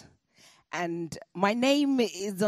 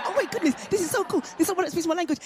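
much higher, laughing with joy; excited delight; 9 semitones higher, much faster than usual. Retold or imitated. Young Ayodele thrilled at meeting Rita, much higher with laughter. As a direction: squeal at finding a fellow speaker. Her own delighted reaction.

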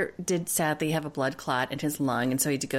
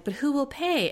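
mock worried question; 10 semitones higher than usual. Mocking Tanya's question.

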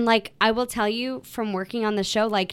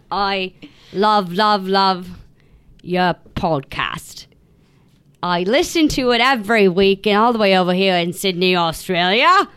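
loud, laboured Aussie read; Australian accent; 5 dB louder than usual. Acted. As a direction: read it out in a hammed-up bad Australian. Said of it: "do an Australian accent".

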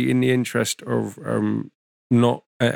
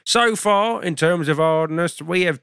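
show host intro; 6 semitones higher, slower than usual. Acted. Host welcoming viewers to the hat show.

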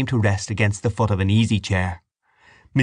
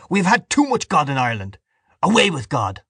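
higher, angry proclamation; defiant cry; 6 semitones higher than usual. A narrator's reading. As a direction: cry out in revolt. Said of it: "he cried".

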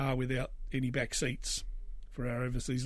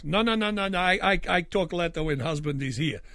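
put-on loud generic European accent, fending off the shopkeeper; 3 semitones higher, 4 dB louder than usual. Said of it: "generic European accent".

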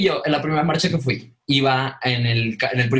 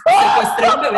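shouting through laughter, much higher and louder; laughing shout; 6 dB louder than usual. Real, not acted. To friends about taking the baby, laughing shout. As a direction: crack up while yelling it. Retelling baby kidnap panic.

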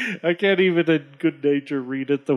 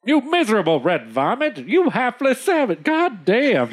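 hammed-up high-pitched cursing; mock fury in a high voice; enraged trader; 7 semitones higher than usual. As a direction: curse like an outraged trader, voice leaping up. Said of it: "The trader says".

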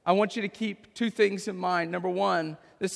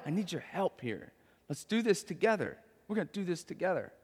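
gentle reassuring dad; 7 dB quieter than usual. Pretend. Dad gently enlisting son.

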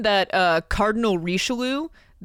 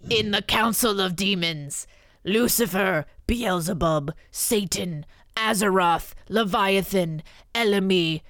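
demon voice. Acted. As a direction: keep reading as the demon. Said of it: "I should be doing a demon voice".

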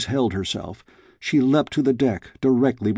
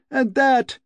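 full shriek; 14 semitones higher than usual. A narrator's reading. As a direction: scream the last blow home. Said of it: "she shrieked".

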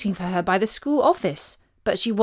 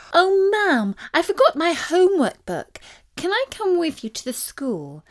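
put-on higher-pitched child asking Mum a favour; pleading schoolgirl; 8 semitones higher than usual. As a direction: play a daughter with an innocent excuse, pitched up. Child wheedles her mum in story.

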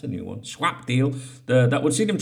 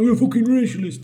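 imagined accuser; 8 semitones higher, 5 dB louder, faster than usual. Pretend.

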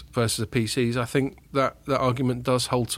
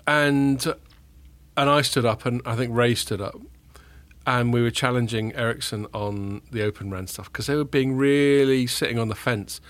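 usual speaking voice

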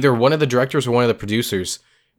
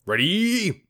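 booming fighting game announcer. Pretend. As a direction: stretch each weight out like a prizefight intro. Boxing-match introduction of two hosts.

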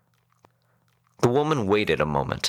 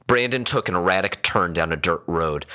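ordinary delivery, nothing marked

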